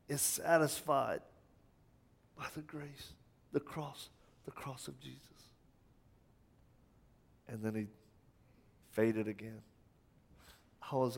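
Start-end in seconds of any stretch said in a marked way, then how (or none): 0.1-5.2 weak dying voice. Retold or imitated. Dying man barely able to talk.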